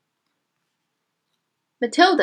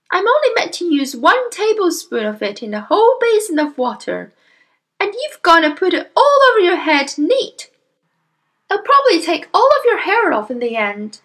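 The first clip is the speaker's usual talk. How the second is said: high-pitched nagging wife; exasperated mother; 9 semitones higher, 4 dB louder than usual. Acted. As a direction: scold your husband in a high voice. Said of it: "the mother cried".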